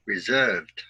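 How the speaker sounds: "reserved" with the stress on SERVED: re-SERVED; "reserved" modelled for students to repeat, SERVED stressed; model word stress. Shown as a demonstration. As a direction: say "reserved" clearly and push the second syllable SERVED. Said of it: "listen and repeat after me".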